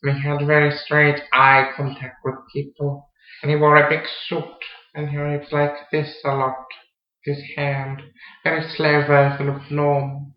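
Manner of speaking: unnervingly calm, deliberate madman demonstration; slow, calm character. Shown as a demonstration. Actor showing how his mad character spoke, slow and calm. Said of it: "very slowly and calmly like this".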